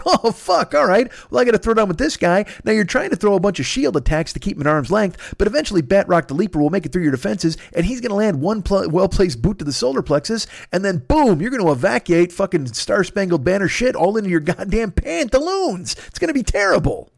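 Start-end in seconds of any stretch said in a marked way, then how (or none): 0.0-2.4 much higher, startled oh fuck; mock alarm. Cap reacting to Batroc showing up. 14.9-15.9 pantaloons stretched very slow and much higher; drawn-out punchline. Acted.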